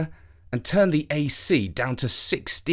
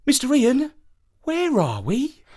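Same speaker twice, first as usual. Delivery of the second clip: put-on child asking; 12 semitones higher than usual. A narrator's reading. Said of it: "Jeremy asked".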